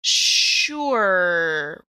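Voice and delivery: usual speaking voice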